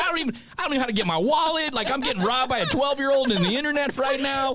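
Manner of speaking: high, fast panicked babble. Retold or imitated. Robbed by a twelve-year-old, high panic. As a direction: flail in high-pitched panic.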